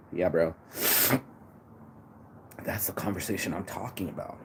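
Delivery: ordinary delivery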